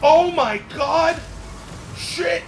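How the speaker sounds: high, loud, stretched out; loud panicked yelling; shocked shout. Real, not acted. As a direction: yell in alarm. Losing speed mid-race.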